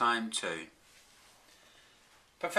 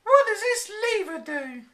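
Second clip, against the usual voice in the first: high, louder eager question; excited wife; 9 dB louder than usual. A narrator's reading. Flora curious about the forbidden lever, high excited voice. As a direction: ask it eagerly, high-pitched. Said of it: "She ran excitedly".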